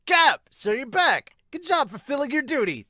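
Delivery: put-on high, loud eager boy voice; loud cheerful kid bursting into the room; excitable Sawamura. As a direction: burst in bright and keen as the hyper rookie. Sawamura greets Miyuki loudly.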